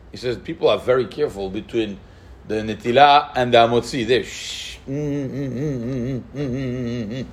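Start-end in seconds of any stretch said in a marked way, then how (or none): 4.1-7.3 soft mm mm mumbling through closed lips; mimed mumbling. Shown as a demonstration. Rabbi demonstrating how people mumble after washing hands. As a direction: hum like someone trying to talk without words. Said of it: "They talk like this".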